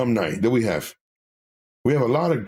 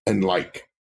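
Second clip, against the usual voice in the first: ordinary talk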